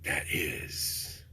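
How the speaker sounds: whispered slowly, a mock solemn punchline; sarcastic whisper. Vlogger ending a news rant, whispered mock solemnity. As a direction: drop to a slow breathy whisper to mock the idea.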